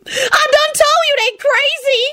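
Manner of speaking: very high, slow lament; piercing drawn-out complaint; desperate wail. A narrator's reading. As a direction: wail it hopelessly. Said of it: "Shalonda wailed desperately".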